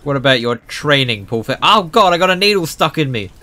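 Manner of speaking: ordinary talk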